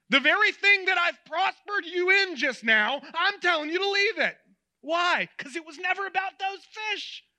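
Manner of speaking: high, loud preaching voice; impassioned command. Acted. Jesus tells Peter to leave his nets, impassioned. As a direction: press the point home with rising intensity.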